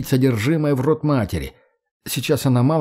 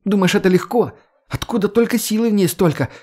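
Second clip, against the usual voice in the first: high, strained shriek of effort; 8 semitones higher than usual. Acted. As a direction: squeal it out under strain. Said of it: "взвизгнула девушка".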